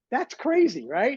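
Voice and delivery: mock astonished aside, laughing inside; amused inner thought. Patient sees a man eat a cheeseburger at the cardiologist, amused. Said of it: "I'm laughing on the inside going".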